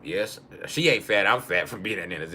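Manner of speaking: normal talking voice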